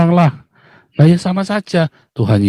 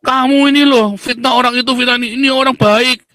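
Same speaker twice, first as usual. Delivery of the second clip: acted indignation; 11 semitones higher than usual. Speaker voices a duped relative scolding whoever warned them, indignant. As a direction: play someone outraged at a slander, pitched well up. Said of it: "Pertama dia marah".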